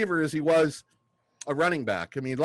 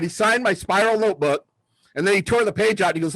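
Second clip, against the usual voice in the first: ordinary talk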